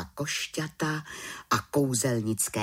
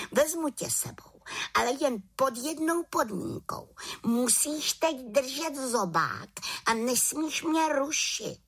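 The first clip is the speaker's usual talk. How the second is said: put-on high-pitched young witch laying down terms; high, strict little witch voice; little witch, bossy; 14 semitones higher than usual. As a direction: set a firm condition in a high voice.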